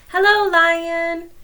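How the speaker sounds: butter up the lion; sly greeting. Acted. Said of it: "Anansi said to Lion".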